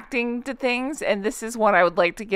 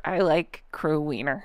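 slow, softer quote with a laugh; amused mimicry; 5 semitones lower, 6 dB quieter than usual. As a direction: lightly imitate the line, slowly, chuckling. Quotes the clip's line, amused.

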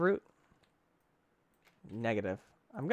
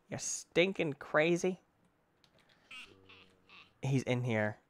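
normal talking voice

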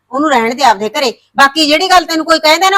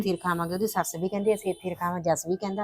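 hushed worry; 5 semitones lower, 12 dB quieter than usual. Acted. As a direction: ask it under your breath. Worried mother talks privately. (ਹੌਲੀ ਫ਼ਿਕਰਮੰਦ ਆਵਾਜ਼)

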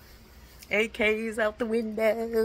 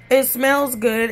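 normal talking voice

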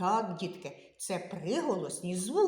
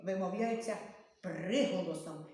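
ordinary talk

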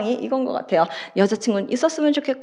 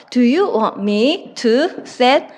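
very slow, word by word; slow dictation; much slower than usual. Shown as a demonstration. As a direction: spell it out slowly for students to write. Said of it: "이렇게 얘기를 하면 돼요".

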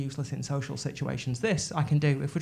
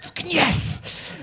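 gleeful yes; 11 semitones higher, much slower than usual. Retold or imitated. Gleeful reaction to Doctor Who intro.

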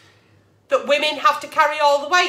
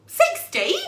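shocked disbelief; 11 semitones higher than usual. Real, not acted. Agony aunt stunned by a sixteen-year engagement. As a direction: leap up high in disbelief.

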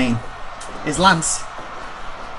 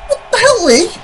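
flustered disbelief; 8 dB louder than usual. Real, not acted. Exposed on video, disbelief.

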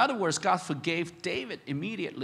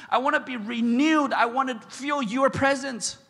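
much higher and louder, pleading; impassioned prayer; 8 semitones higher, 6 dB louder than usual. Acted. Preacher praying as David for renewal, passionate. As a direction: cry out to God with longing.

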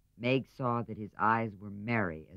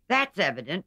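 higher, louder and dry; old lady voice; 8 semitones higher, 8 dB louder than usual. A narrator's reading. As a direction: sniff it out like a stern aunt. Aunt March dry retort.

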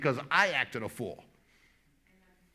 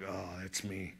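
sheepish mumble; 9 semitones lower, 12 dB quieter, slower than usual. Pretend. Congregation caught out.

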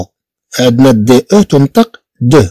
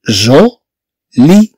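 "جو" and "لي" said apart with a pause between; split syllables; much slower than usual. Shown as a demonstration. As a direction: break "جولي" into two beats. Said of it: "ممكن أنني أقسمها فأقول".

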